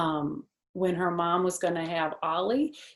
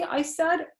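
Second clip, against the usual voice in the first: usual speaking voice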